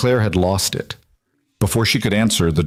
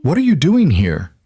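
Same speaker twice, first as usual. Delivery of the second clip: accusing question, pitched up; a touch of hostility, higher voice; hardened; 7 semitones higher than usual. A narrator's reading. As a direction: challenge an unwelcome visitor. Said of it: "his expression hardened".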